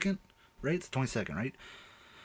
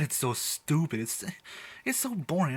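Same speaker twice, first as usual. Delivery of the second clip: ordinary talk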